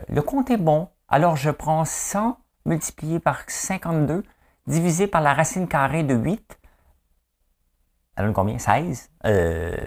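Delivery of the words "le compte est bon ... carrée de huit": high, deliberate quiz-show reckoning; mimicked TV maths contestant; human calculator impression. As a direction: reel off the sum in a high, measured voice. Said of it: "de voir des calculatrices humaines".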